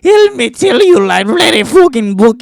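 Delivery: high, loud hammed-up fortune-teller accent; over-the-top Slavic crone accent; mock Slavic accent. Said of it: "I'm not good with accents".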